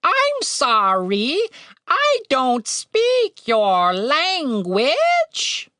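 very slow, high, over-enunciated bird; painstakingly drawn-out words in a high voice; slow, careful articulation. A narrator's reading. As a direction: spell it out as if to a foreigner. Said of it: "his speech was slower, louder, and very carefully articulated".

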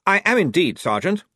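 high, loud and brisk; briskly. Acted. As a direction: snap it out briskly. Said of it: "said Blouse briskly".

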